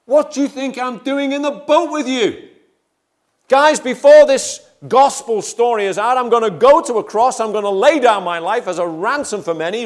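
high, loud, exasperated rebuke; Jesus rebuking disciples. Retold or imitated. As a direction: scold them loudly for doubting. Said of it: "imagine the Lord Jesus saying to them".

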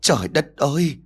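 a mild wail of despair. A narrator's reading. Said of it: "miệng bắt đầu bù lu bù loa". (than trời, kêu la)

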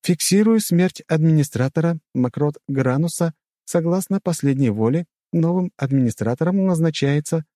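high-pitched city system announcer; high public-address voice; loudspeaker announcement voice. A narrator's reading. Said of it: "заговорил голос из динамиков города".

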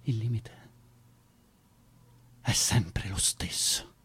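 low slow whisper. Acted. Actor tells Zoe the hard truth, whispered slowly. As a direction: drag it out, heavy and hushed.

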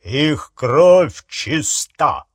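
slow, ringing pronouncement; loud declaration. A narrator's reading. Harry declares the Muggle-borns' blood pure, loud declaration. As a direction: proclaim it so it carries across the hall. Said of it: "голос его громким эхом прокатился".

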